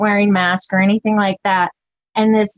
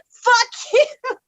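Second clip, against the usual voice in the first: squeaky mock old man; 14 semitones higher than usual. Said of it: "old man's retort quoted".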